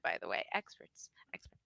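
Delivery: whispered aside; hushed side comment. Real, not acted.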